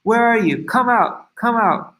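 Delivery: calling out high to the demon; a storyteller's light mock shout into the forest. A narrator's reading. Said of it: "he was shouting".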